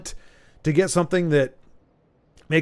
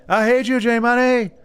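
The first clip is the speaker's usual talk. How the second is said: light higher mock of a friend; imitating Lagmeister; 7 semitones higher than usual. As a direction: echo a buddy's teasing line, higher. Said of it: "Lagmeister says that to me a lot".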